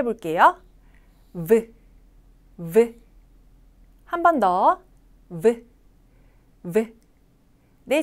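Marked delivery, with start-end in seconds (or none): 1.3-3.0 short isolated "V" with audible voicing; voiced V sound. Shown as a demonstration. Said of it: "성대의 진동이 있어야 돼요". 5.4-6.9 short isolated "V" with audible voicing; voiced V sound. Shown as a demonstration. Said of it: "성대의 진동이 있어야 돼요".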